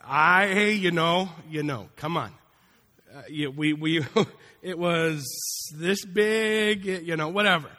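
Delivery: loud evasive fish tale with a laugh; boasting fisherman. Retold or imitated. Fisherman exaggerating his catch, loud and evasive. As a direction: play the fibbing fisherman.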